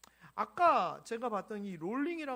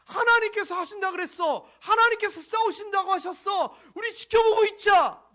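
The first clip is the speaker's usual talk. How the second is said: loud, high, confident proclamation; ringing shout; 10 semitones higher, 17 dB louder than usual. Acted. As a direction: shout faith to the frightened crowd. Moses calling out to the people.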